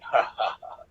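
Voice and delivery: low, slow "ha, ha, ha"; mock movie-villain laughter; imitated villain laugh. Caller imitates the thing laughing at him, sinister laugh. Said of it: "that thing starts laughing".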